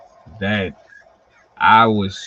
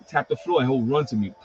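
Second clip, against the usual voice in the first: usual speaking voice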